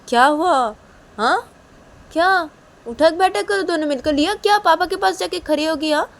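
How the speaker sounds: high, loud yelling; yelling mother. Acted. Ruhi yelling at Liya and papa to do sit-ups. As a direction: yell at them to get moving.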